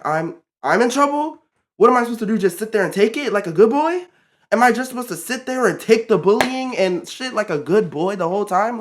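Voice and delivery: indignant questioning. Real, not acted. Indignant rhetorical questions about unfair blame.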